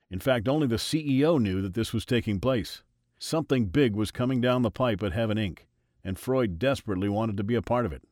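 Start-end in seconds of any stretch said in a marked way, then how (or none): none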